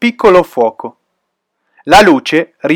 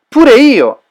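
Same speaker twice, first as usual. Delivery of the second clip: admitting; 8 semitones higher, 5 dB louder than usual. Acted. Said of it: "ammise Melek".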